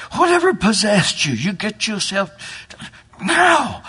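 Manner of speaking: high, loud angry scolding; scolding mother hen. Acted. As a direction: scold the runaway chick sharply. Mama Hen scolds duckling.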